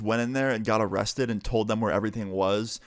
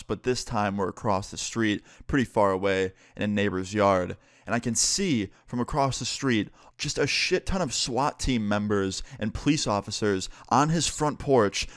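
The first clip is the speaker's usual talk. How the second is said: ordinary delivery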